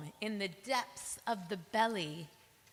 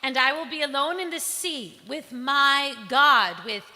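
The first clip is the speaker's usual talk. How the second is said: Jonah's loud plea; 3 semitones higher, 13 dB louder than usual. Acted. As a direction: call out over the storm. Jonah commands sailors in storm.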